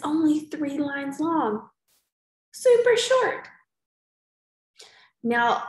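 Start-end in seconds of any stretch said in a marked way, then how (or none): 2.5-3.5 high, loud, excited exclaim; teacher thrilled at how short it is. Real, not acted. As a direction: make it sound delightfully short. Teacher enthusing to kids.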